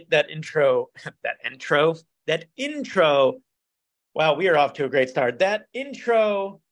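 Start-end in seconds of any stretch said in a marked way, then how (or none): 0.1-3.3 slow, deliberate "intro"; careful retry. Real, not acted. Host retrying the word he fumbled, slow and careful. 5.4-6.6 "intro" drawn out very slowly, pitched higher; deliberate retry. Real, not acted. Host resuming after a bad start, slow and deliberate. Repeats fumbled word with care.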